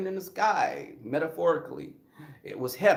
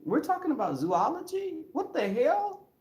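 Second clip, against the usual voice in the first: rising to a much higher, baffled squeal; baffled inner voice climbing far higher; incredulous inner thought; 7 semitones higher, 3 dB quieter than usual. Retold or imitated. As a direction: build from a soft, higher mutter to a shrill, disbelieving squeak. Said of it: "I'm thinking".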